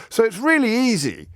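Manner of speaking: really easy in a high, hammed-up posh voice; mocking posh echo. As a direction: echo it back in mock posh, pitch up high. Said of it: "I'm doing a silly accent".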